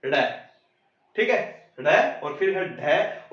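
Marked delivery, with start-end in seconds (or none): 0.1-0.2 ड़ as an abrupt retroflex flap; tongue thrown down. Shown as a demonstration. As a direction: flick the tongue hard on ड़. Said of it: "एकदम से पटक देने से". (ड़ जीभ को एकदम पटककर)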